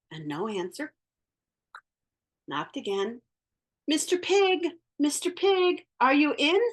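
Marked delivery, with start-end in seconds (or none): 3.8-6.6 calling out. Retold or imitated. Wolf calls at the door.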